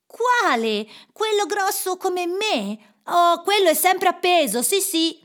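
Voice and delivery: put-on high-pitched boyish voice; eager boy. A narrator's reading. Boy answers Scrooge about the turkey, eager. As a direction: pipe up eagerly, calling back.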